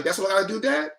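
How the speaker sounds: put-on high-pitched little-boy voice; eager young son. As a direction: pipe up like a kid taking on a task. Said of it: "Kid was like".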